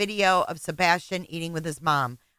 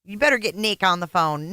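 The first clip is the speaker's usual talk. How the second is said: usual speaking voice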